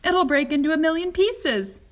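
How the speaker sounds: loud, high alarmed cry; terrified of the glass breaking; frightened yell; panicked shout. A narrator's reading. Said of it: "shouted Grandpa Joe".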